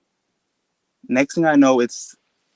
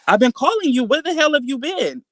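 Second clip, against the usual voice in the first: high-pitched, exasperated demand; mock-yelled parental scolding, pitched way up; scolding mom; 13 semitones higher than usual. Mom confronting her missing child, scolding.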